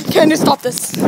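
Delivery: ordinary talk, nothing marked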